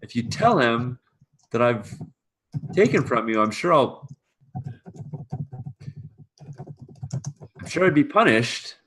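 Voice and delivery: high, halting plea; anxious pleading servant. Acted. Servant fears punishment from master, anxious plea. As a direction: beg not to be told on, faltering.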